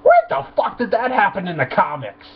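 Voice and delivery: ordinary talk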